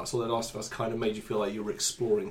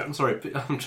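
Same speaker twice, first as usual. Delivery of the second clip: ordinary delivery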